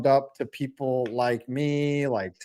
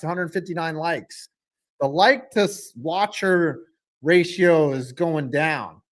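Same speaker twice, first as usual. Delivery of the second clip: usual speaking voice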